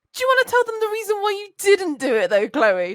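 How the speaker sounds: imitating Kat teasing. Coworker baiting Chloe to confess, loud teasing quote. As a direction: needle her, sing-song and sly. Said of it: "she goes".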